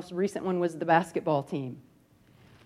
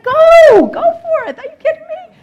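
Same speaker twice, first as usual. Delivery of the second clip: much higher and louder, cheering her on; excited cheering; 16 dB louder than usual. Retold or imitated. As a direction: yell with joy, urging her to go. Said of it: "I was like".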